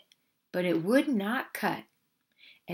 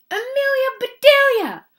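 high, loud outrage easing at the end; angry shout; 11 semitones higher, 6 dB louder than usual. A narrator's reading. Mister Rogers confronts his maid about his fish, shouting. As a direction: start at a shout, then demand an answer. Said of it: "he shouted".

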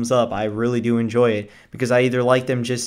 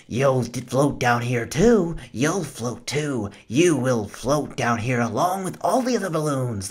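put-on drunk-sounding Pennywise. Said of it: "he sounds like almost a drunk".

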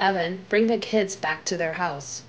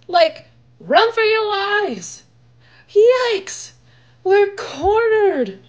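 much higher, louder, stretched-out panic; panicked yelling; 13 semitones higher, 8 dB louder, much slower than usual. Acted. Shaggy running from the scarecrows, loud and panicked. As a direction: flee screaming from the monsters.

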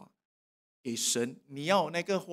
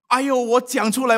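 usual speaking voice

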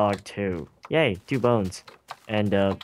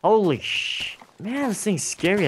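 being chased; 9 semitones higher than usual. Real, not acted. Fleeing the Enderman.

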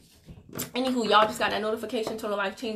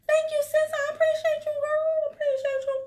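much higher, cooing thanks; much higher, sweet thank-you to a commenter; 5 dB louder than usual. Real, not acted. Thanking a viewer for her comment.

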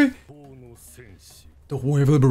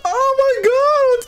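delighted squeal; 11 semitones higher than usual. Real, not acted. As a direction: stretch it out high in delight. Reacting to characters on screen.

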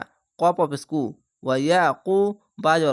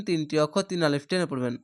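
normal talking voice

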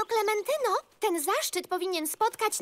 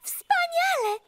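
thrilled; 8 semitones higher, slower than usual. Acted. Thrilled to carry the message.

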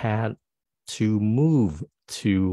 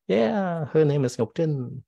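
gleeful; 7 semitones higher, slower than usual. Shown as a demonstration. Teacher joking happy example.

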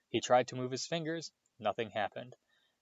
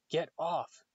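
higher, startled order; loud command; 6 semitones higher than usual. A narrator's reading. Harry waking to a stranger's touch, mildly loud command. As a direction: push someone away with a sudden raised pitch. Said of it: "he said loudly".

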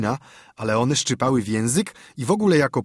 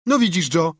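put-on lively boyish call-out, higher; wołanie; 6 semitones higher, 4 dB louder than usual. A narrator's reading. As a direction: call out to a pal, pitched up. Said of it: "zawołał Tomek". (zawołane żywo, wyższym głosem)

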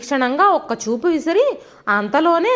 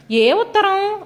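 higher-pitched puzzled question; a touch of astonishment, pitched up; mild surprise; 7 semitones higher, slower than usual. A narrator's reading. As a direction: ask it slightly taken aback. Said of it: "కాస్త ఆశ్చర్యంగా".

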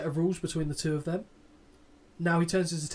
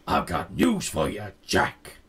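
much lower, cocky growl of a promo line; Shawn impression; 9 semitones lower, 3 dB louder, slower than usual. Host impersonating Shawn's promo catchphrase. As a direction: drop low and sneer the catchphrase. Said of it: "He goes".

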